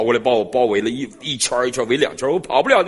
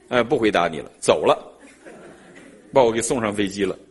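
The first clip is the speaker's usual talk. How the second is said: usual speaking voice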